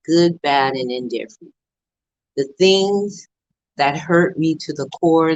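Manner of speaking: ordinary delivery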